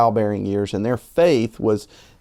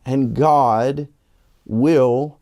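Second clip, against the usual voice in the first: preaching build; 3 semitones higher than usual. Acted. Closing sermon appeal.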